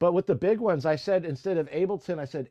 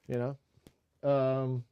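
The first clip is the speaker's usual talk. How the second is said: ordinary talk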